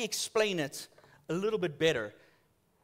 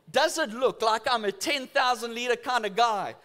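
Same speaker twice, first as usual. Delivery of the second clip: usual speaking voice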